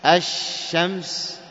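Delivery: "الشمس" very slowly, ash... shams, sh prolonged; lam merged into shin. Shown as a demonstration. Teacher repeating the example for students, slow merged "الشمس". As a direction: hold the doubled shin in "الشمس", no lam sound. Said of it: "تدغم هذه اللام في ماذا؟ في الشين".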